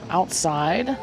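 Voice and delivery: ordinary delivery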